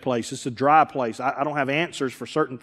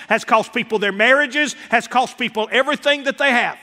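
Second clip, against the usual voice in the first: loud, high rolling sermon cadence; preaching at full force; 7 semitones higher, 4 dB louder than usual. Real, not acted. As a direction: hammer each line home.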